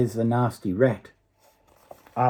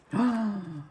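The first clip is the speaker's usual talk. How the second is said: gasp; 10 semitones higher, 4 dB quieter than usual. Pretend. Reader reacts to the soldier sinking.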